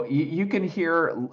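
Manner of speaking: ordinary delivery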